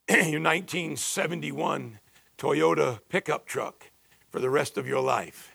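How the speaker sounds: ordinary delivery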